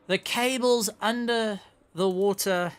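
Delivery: ordinary talk, nothing marked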